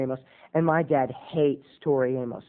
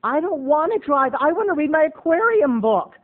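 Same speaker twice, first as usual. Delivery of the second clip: high, louder whiny mom voice; 10 semitones higher, 4 dB louder than usual. Retold or imitated. Mom refusing to drive, higher whiny mock voice. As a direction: whine it like a put-upon mom who won't drive. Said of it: "she's like".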